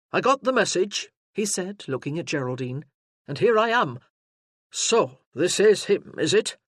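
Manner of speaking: a burly sergeant taking charge; booming soldier arriving in force; gruff sergeant. Acted. Sergeant bursts into the room.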